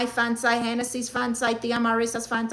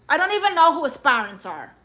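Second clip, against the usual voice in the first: normal talking voice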